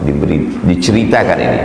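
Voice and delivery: ordinary talk, nothing marked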